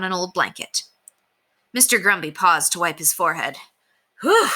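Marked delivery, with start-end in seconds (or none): 4.2-4.5 loud tired puff of breath; exhaled whew. A narrator's reading. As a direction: blow out a big relieved whew. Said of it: "he exclaimed".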